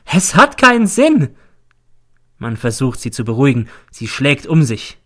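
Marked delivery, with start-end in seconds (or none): 0.0-1.4 high piercing shriek, much louder. Acted. Mother shrieks at the hearing, hysterical shriek. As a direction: scream it out in hysteria. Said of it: "Dann kreischt sie".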